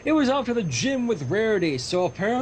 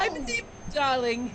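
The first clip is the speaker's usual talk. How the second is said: slow, high posh-lady purr; mock Rarity; 7 semitones higher, slower than usual. Rarity voice bit.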